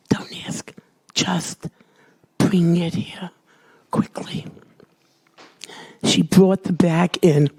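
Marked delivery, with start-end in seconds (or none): 0.1-4.5 hushed, pressing whisper; urgent whisper. Retold or imitated. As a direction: whisper it fast, keep it secret. Urging the nurse secretly.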